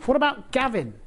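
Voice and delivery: put-on high, hopeful little-girl suggestion; cheeky child. Little Mary cheekily suggesting a name to angel. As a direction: offer it like a cheeky kid.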